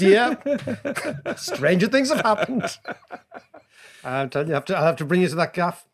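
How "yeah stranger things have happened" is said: laughing reply. Retold or imitated. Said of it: "And I said".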